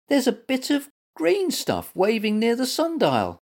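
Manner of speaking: high-pitched small creature squeak; squeaky little animal. A narrator's reading. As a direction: squeak it out in wonder. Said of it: "squeaked Little Mutt".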